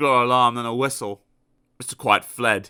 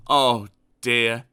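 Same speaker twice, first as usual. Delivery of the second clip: ordinary delivery, nothing marked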